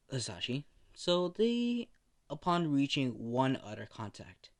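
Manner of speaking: usual speaking voice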